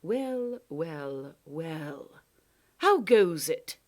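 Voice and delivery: smug drawn-out well well well; a gloating villain entering; lightly voiced Proc. Proc gloats at the doorway over trapped Lindy, smug.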